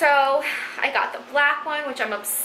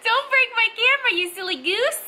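playful scolding; 12 semitones higher than usual. Real, not acted. Toddler grabs camera.

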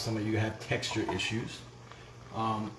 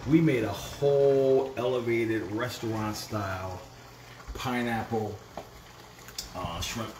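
normal talking voice

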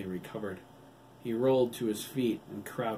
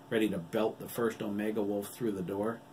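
ordinary delivery

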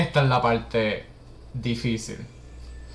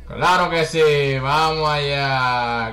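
loud triumphant cheer; excited cheer; 7 dB louder than usual. Real, not acted. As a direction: cheer the win loudly. Success in game.

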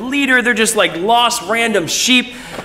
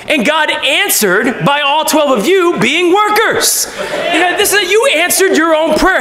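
joyful Jesus reveal; 7 semitones higher than usual. Retold or imitated. Jesus telling the twelve they are the workers, joyful. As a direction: savour the surprise, then shout it.

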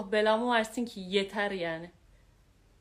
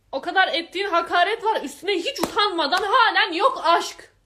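loud, high, laughing in disbelief; exasperated laughter; 8 semitones higher, 9 dB louder than usual. Real, not acted. Laughing in disbelief at the ex's insults to Ayşenur. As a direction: laugh it off through the anger.

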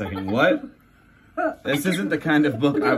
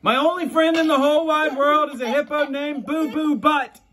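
silly high voice; 11 semitones higher than usual. Acted. Reading silly line.

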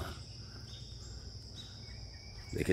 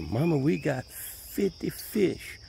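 put-on slow, higher, a light voice of a child; excited kid calling mama; 6 semitones higher, 4 dB quieter, much slower than usual. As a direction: play the eager kid who caught fish, stretched out. Kids reporting their catch.

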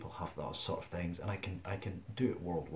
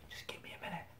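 whispered plea; 10 semitones higher, 6 dB quieter than usual. Retold or imitated. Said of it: "and I'll go".

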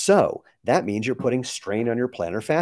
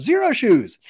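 high, loud yell of the brand name; excited stranger yelling at passersby; retold yell; 13 semitones higher, 7 dB louder, slower than usual. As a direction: shout it like a guy braking on a bike. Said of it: "spun around and yelled".

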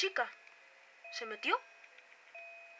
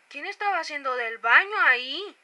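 louder, slow indignant demand; louder mock accusation; 9 dB louder than usual. Real, not acted. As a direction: call out the culprit loudly. Repeated louder, slower. (acusación indignada en voz alta)

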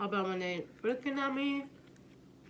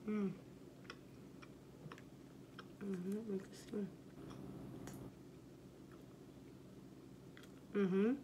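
chewing while speaking; muffled closed-mouth murmur; mouth full; 13 dB quieter than usual. Real, not acted. Seen 3: Chews with closed, full mouth throughout; food looks like nugget, not fries.

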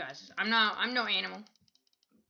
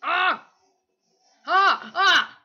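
loud, high "ah" cries; short yells; 7 semitones higher, 10 dB louder, much slower than usual. Real, not acted. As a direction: yelp sharply in the heat of the game. Yells during game action.

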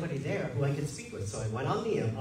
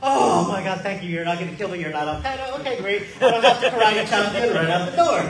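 laughing with relief, louder; relieved laughter; 3 semitones higher, 8 dB louder than usual. Retold or imitated. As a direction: gush out relief between laughs. Relieved after fearing the man.

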